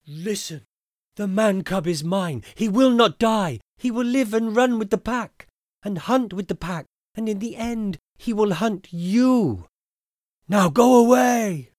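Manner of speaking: high, loud protective mother wolf; fierce mother wolf. Acted. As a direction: stand over the cub and defend it.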